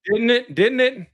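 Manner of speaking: high British tag questions; loud-then-high put-on British; mock British accent. Co-host joins in as British guy, mock British accent. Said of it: "British guy".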